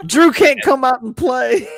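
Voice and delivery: high, loud, sing-song taunt; mock parent. Teasing Drew about being grounded by his parents, mock parent. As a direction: tease like a parent calling out.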